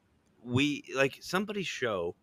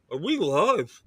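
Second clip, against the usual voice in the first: imitating a friend talking through cereal; a higher mock groggy question; mouth full; 7 semitones higher, slower than usual. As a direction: say it round a mouthful. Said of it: "He's got food in his mouth".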